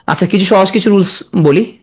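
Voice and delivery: usual speaking voice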